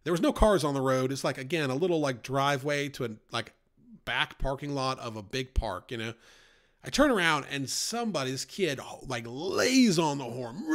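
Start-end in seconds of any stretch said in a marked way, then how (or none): none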